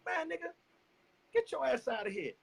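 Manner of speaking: imitated incredulous questioning; mock indignant challenge. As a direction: play someone challenging an unwelcome newcomer. Acts out man confronting a friend.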